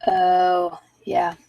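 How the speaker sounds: normal talking voice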